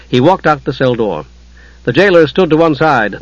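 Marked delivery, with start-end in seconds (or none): none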